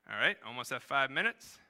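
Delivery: ordinary delivery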